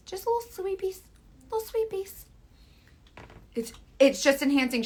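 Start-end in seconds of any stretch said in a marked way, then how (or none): 0.1-2.3 high, soft little squeak; tiny voice. Pretend. Showing viewers tiny contour strokes, in tiny voice. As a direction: shrink it up high and small.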